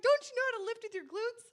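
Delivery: high, loud, quick mock disbelief; teasing hard time. Wife ribbing husband about lifting, teasing disbelief. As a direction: needle him with an exaggerated question. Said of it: "just giving him a hard time".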